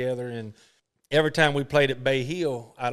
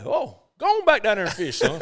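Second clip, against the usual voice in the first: imitated, higher and louder; Arnold Palmer; 8 semitones higher, 6 dB louder than usual. Palmer sending Boo back to fish, louder imitated voice. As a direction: shoo him off kindly. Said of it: "he's like".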